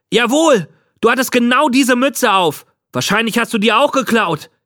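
put-on very high, worked-up boy; emphatic accusation. A narrator's reading. Boy to the old man about his hat, accusing hotly. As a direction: insist on it, voice pitched right up.

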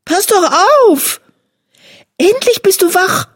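high, lively kitten chatter; cheeky sister kitten. A narrator's reading. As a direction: bounce in, half scolding, half glad. Sister kitten lands on him.

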